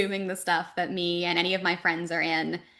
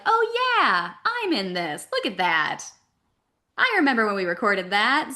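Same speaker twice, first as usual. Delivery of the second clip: mock excited recognition; 2 semitones higher, 5 dB louder than usual. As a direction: play a viewer suddenly spotting themselves. Acts out spotting herself on screen.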